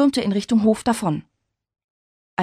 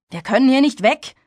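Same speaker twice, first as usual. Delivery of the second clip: objecting; 5 semitones higher, 5 dB louder than usual. A narrator's reading. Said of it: "wandte Jake ein".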